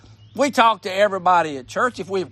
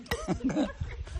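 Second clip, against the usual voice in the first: soft laugh; 9 semitones higher, 12 dB quieter than usual. Real, not acted. Laughs at swallowing a bug.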